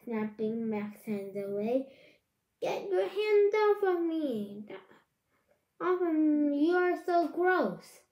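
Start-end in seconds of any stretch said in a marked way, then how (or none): 2.6-8.0 higher and louder, pushing him off; angry yelling. Acted. Girl yelling at the boy teasing her, angry and loud. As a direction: shout him away in disgust. Chloe yells at Max.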